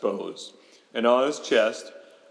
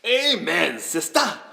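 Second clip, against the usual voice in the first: higher, punchy exclamation; exclaiming spirit; 6 semitones higher than usual. A narrator's reading. Spirit agreeing with Megan, exclaiming. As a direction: cheer it out in agreement. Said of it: "the spirit exclaimed".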